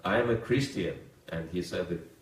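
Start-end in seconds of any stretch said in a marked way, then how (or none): 0.0-0.9 a hint of puffed-up pride, slightly louder; proud. Drunk man announcing his faith to the monks, mildly proud. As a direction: say it like a badge of honour. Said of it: "in a very proud way".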